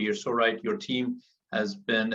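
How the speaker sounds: usual speaking voice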